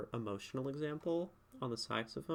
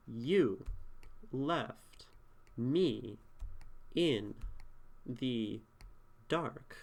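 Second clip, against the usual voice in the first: "you left me in the dark" read one word at a time with long pauses; much slower than usual. Shown as a demonstration. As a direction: give each word of "you left me in the dark" its own beat and its own weight. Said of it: "I would almost read this like that".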